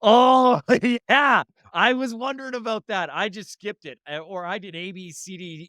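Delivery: high-pitched mock chorus of students, loud then softening; relieved classmates chiming in. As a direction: burst out with a big "oh yeah", then tail off. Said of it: "They all go".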